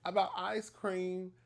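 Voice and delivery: high, loud exasperated scolding; slow down and lean on each word, fed up; frustrated. Retold or imitated. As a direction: uncle telling off his niece, exasperated. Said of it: "now I'm getting frustrated".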